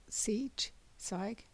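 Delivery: ordinary delivery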